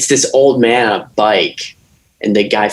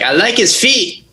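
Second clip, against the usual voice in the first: high, slow goofy voice; mock Quentin; 10 semitones higher than usual. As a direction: ham up a creepy fan of feet. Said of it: "Quentin's like".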